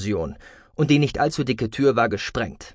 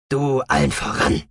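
contemptuous; 2 semitones higher than usual. A narrator's reading. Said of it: "schmetterte er sie verächtlich ab".

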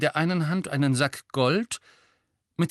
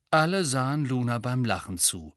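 usual speaking voice

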